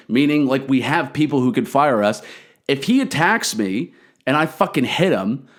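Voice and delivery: ordinary delivery, nothing marked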